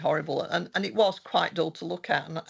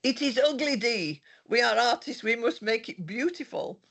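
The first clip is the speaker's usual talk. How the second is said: higher, lightly put-on Italian accent; 8 semitones higher than usual. Retelling her course leader's verdict on the app, in a mock Italian accent. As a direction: quote him in a gentle Italian accent. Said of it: "in a beautiful It-, Italian accent".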